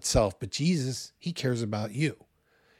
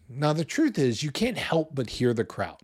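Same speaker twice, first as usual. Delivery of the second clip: ordinary talk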